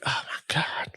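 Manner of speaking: breathless whisper of disbelief; whispered aside. Retold or imitated. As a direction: whisper it under your breath. Aside while the car pulls up.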